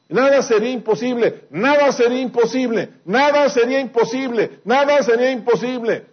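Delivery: preacher stuck repeating for emphasis, pitch climbing; building repetition. Acted. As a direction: hammer nada again and again, rising. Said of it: "Estaba rayado el disco".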